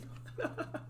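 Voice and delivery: high breathy giggle; laughing. Real, not acted. Voice actor loses it over own voice, giggling. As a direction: crack up at your own silly voice.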